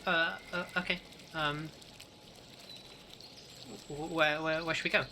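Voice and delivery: in-character voice. Acted. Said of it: "she says".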